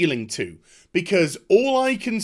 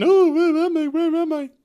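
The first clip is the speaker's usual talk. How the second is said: much higher, hammed-up dazed voice; mock lost heroine; 11 semitones higher, 4 dB louder than usual. Reviewer mocking the heroine's confused line, hammed up high. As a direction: parody a confused heroine waking up.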